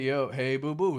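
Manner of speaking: ordinary talk, nothing marked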